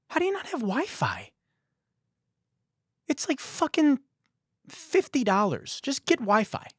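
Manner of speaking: high-pitched mock exasperation; exasperated disbelief. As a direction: complain in comic disbelief, voice pitched right up. Acted complaint to the barista.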